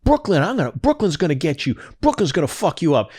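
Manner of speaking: high mock tirade of curses; imitating a furious rant. Retelling Tony cursing out his friend, a high mock rage. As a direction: ham up a man losing it, pitched high.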